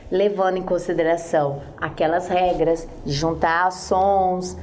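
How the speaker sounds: ordinary talk, nothing marked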